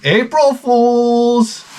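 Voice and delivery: high, slow singsong April Fool's; kid gotcha voice. Retold or imitated. Son teasing his dad, high and slow. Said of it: "he's like".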